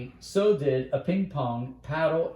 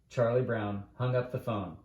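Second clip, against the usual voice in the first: plain reading voice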